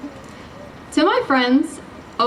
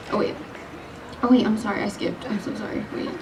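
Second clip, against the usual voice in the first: embarrassed whisper; 3 semitones lower, 7 dB quieter than usual. Real, not acted. Realizes skipped lines.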